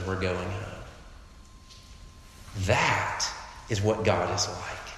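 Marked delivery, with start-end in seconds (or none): 0.0-0.8 low, soft, understated firmness; solemn. Retold or imitated. Dad taking his son out of the house, quietly solemn. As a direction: quietly settle it, calm and final. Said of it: "get very solemn".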